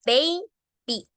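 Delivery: put-on baby drawn into two syllables, "bey-bee"; pronunciación modelo. Shown as a demonstration. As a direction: say baby with long A, then Y as "ee". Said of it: "se pronuncia I". (baby en dos sílabas, "bei-bi")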